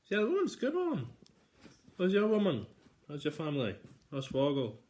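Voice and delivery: loud, high mock greetings; shouted greetings. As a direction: holler them across a room.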